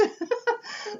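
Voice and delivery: a rising giggle at herself; giggle, embarrassed; high embarrassed laugh. Real, not acted. Caller must think she's crazy, she gives a high rising giggle.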